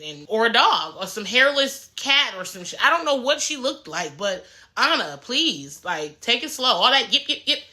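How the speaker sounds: ordinary talk, nothing marked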